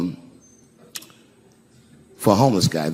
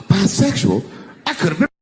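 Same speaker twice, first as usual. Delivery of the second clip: higher-pitched, aghast disbelief; mock horror; 7 semitones higher than usual. Comic reacting to what he read in the paper, mock horrified. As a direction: play scandalized after a gasp. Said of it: "I was horrified".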